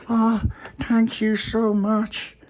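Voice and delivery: very high, slow, drawn-out grateful squeak; little puppy. Acted. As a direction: stretch the thanks out like a tiny pup. Puppy character voice.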